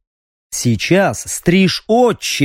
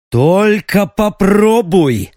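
slow, higher defiant bark; angry bark; 5 semitones higher, much slower than usual. Acted. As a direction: snap it with all your will. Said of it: "рявкнул Стриж".

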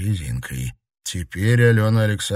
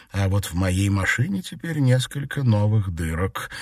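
plain reading voice

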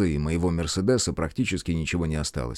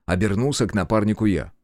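ordinary delivery, nothing marked

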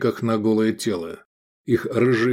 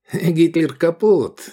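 high, slow gleeful jeer; grinning taunt; 7 semitones higher, 4 dB louder, slower than usual. A narrator's reading. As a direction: crow it at a beaten enemy. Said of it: "осклабился Эдик". (протяжно, со злорадной ухмылкой)

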